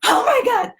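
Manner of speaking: high, alarmed oh my God; freaking out at the news; panicked oh my God. Retold or imitated. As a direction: gasp it out in alarm. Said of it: "I was like".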